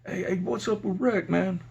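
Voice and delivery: half-hearted holler for attention; mock inmate holler. Inmates asking the officer about rec, mildly hollered imitation. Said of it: "inmates would holler out".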